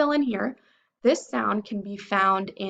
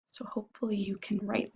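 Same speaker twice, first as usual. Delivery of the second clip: whisper; 5 dB quieter than usual. Real, not acted. Closing note to students.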